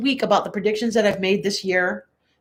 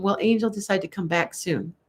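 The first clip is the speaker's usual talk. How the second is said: usual speaking voice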